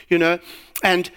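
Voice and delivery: normal talking voice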